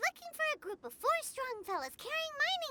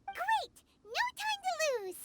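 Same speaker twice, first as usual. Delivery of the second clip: excited; 8 semitones higher than usual. Acted. Eager to chase the miners.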